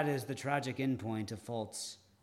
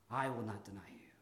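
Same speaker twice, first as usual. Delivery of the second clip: a hushed, breathy whisper; whispered vow; 5 semitones lower, 9 dB quieter, faster than usual. Retold or imitated. Peter vowing loyalty to Jesus, whispered. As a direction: drop to a bare whisper.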